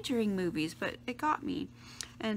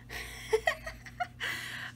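giggle; 5 dB quieter than usual. Real, not acted. Laughs after whispering.

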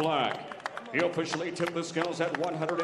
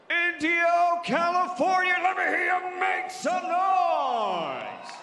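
much higher, slow stretched shout; crowd hype shout; 12 semitones higher, 5 dB louder, slower than usual. Acted. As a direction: whip the arena crowd into noise. Announcer hypes the crowd.

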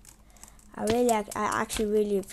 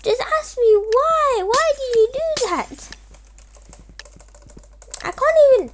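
exasperated whine; 13 semitones higher, 8 dB louder than usual. Real, not acted. Player whining at the attacker who won't leave, high exasperated whine.

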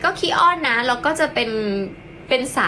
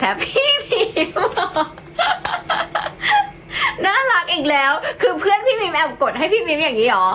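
giggling through the words in delight; laughing; 6 semitones higher than usual. Real, not acted. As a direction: laugh while asking, tickled. Surprised delighted at friend's gift. (หัวเราะไปพูดไป)

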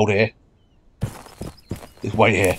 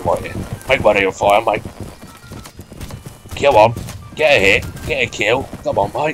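lightly voiced Australian bloke rallying his mates; easygoing Australian accent, mate-heavy; a touch of Aussie accent for a cheeky squad leader; Australian officer. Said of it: "it's Australian Ted".

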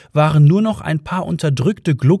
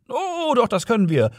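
policeman calling back; 10 semitones higher than usual. A narrator's reading. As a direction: swoop high to contradict them. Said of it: "schrie der Polizist".

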